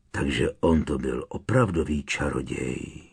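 put-on low grumble of an old man; grumbling old man. A narrator's reading. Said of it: "zamručel stařec". (zamručení starce hlubokým hlasem)

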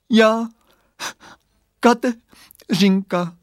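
high, slow, broken by sobs; a small girl torn up by crying; sobbing little voice. A narrator's reading. Crying girl answers boy, high broken sobs. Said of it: "hlásek pláčem potrhaný na kousky".

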